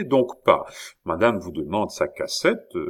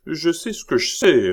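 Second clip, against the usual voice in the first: curt retort; 8 semitones higher, 5 dB louder than usual. A narrator's reading. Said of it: "répliqua monsieur de Sartine".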